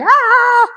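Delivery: sudden high yelp of mock panic; a short high cry, like anxiety boiling over; panicked brain scream. Trainer mimics her anxious brain with a sudden high cry. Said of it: "it's because my brain's going".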